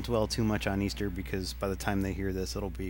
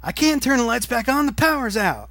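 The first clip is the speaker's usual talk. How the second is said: dude yelling; 13 semitones higher, 8 dB louder than usual. Retold or imitated. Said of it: "a dude say".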